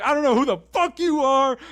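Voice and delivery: hammed-up furious outburst; mock angry shout. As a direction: bark it at a stranger in your house. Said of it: "He's like".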